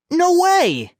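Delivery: high, loud protest; insistent denial. A narrator's reading. Nephew denying theft to his aunt, loud insistent protest. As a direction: fling the denial back hard. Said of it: "Johnny insisted".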